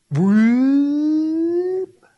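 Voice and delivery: long, high rising whoop; imitated pulling-out noise. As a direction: slide the pitch up in one long sound.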